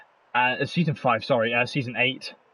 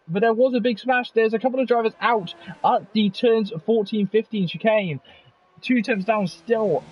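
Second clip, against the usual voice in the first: live play-by-play; 9 semitones higher than usual. Acted. Live qualifying commentary.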